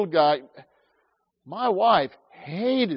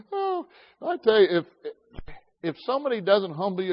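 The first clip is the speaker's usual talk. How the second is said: normal talking voice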